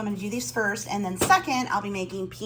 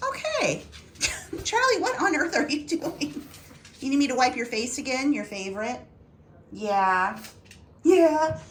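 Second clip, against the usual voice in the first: pet talk; 5 semitones higher than usual. Real, not acted. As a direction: coo at Charlie, pitched way up. Fussing over Charlie's face.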